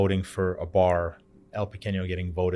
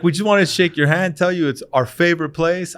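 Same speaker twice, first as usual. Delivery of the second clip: higher and louder, eager praise; eager fans; 9 semitones higher, 9 dB louder than usual. Retold or imitated. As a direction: sound eager to shake his hand. Agents want to shake hand.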